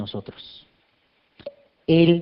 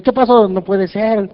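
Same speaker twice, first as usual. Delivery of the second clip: mock alarmed shout, higher and louder; shocked cry; 5 semitones higher, 6 dB louder, slower than usual. Preacher acting his shock at the mirror, mock alarmed shout. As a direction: jump back startled at the mirror. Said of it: "le grito".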